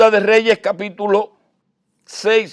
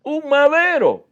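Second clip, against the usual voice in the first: fiery preaching; 7 semitones higher, 6 dB louder than usual. Acted. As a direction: preach it out with full conviction. Preacher rises to full force. (predicación con fuerza y voz alzada)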